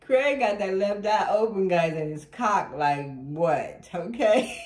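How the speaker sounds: loud, laughter bubbling through; half-laughing, voice pushed out loud; cracking up. Real, not acted. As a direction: blurt it loudly through amusement. Cracking up over the card.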